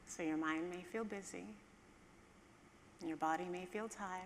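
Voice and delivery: calm, hushed guide easing listeners down; guided meditation. Acted.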